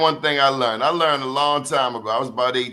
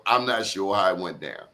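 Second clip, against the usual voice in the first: ordinary talk, nothing marked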